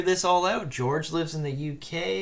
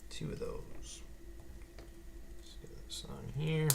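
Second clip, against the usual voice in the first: much softer whisper while concentrating; whispering to self; 14 dB quieter than usual. Real, not acted. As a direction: murmur to yourself over fiddly pieces. Concentrating on fiddly build.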